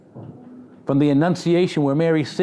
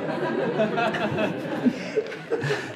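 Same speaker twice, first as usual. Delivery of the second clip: a soft breathy laugh at the crowd; 6 semitones higher, 6 dB quieter than usual. Real, not acted. Preacher laughing with his congregation. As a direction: chuckle along with the room.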